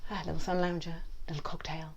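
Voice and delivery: very soft little mole voice; imagined sunbathing mole. Pretend. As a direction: play a mole relaxing, hushed.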